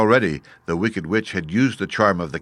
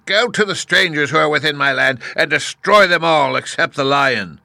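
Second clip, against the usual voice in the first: high, loud witch voice; commanding Wicked Witch; 7 semitones higher, 5 dB louder than usual. A narrator's reading. Wicked Witch to the winged monkeys, high loud command. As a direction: order the monkeys about as an imperious witch.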